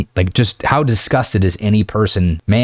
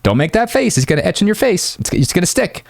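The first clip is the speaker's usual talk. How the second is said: high scolding mom voice; nagging mom; 10 semitones higher than usual. Retold or imitated. A mom warning her kid about making faces, high and scolding. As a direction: scold like a mother at a pulling face. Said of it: "your mom was like".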